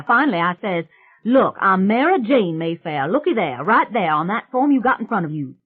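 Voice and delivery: put-on loud, high, brash country girl; a loud, high swamp witch bragging to the family. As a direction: belt it out like a cocky backwoods girl. Mary Jane's rowdy swamp girl voice.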